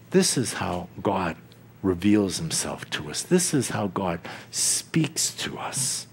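low, hushed and intimate; a hushed, intimate aside. Acted. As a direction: draw the congregation in quietly. Hushed follow-up to quote.

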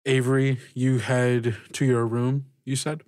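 ordinary talk

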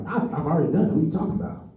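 put-on a kid's outraged high protest; mock indignant twelve-year-old. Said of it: "I'm like".

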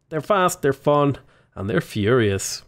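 ordinary talk

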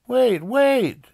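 put-on high, loud boy's call. A narrator's reading. Arthur chasing the departing bus, urgent call. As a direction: cry it out after someone leaving. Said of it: "he called".

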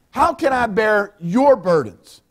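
ordinary talk

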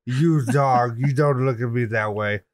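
lightly voiced haughty poodle; snooty poodle voice. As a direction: put on a prissy, uppity dog voice. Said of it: "It's a French poodle, and he's like".

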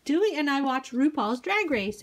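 usual speaking voice

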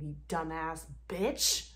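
normal talking voice

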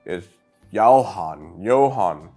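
normal talking voice

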